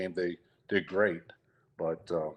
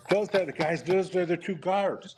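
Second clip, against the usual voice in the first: amused explanation; 8 semitones higher than usual. Retold or imitated. Pointing out guards to wife.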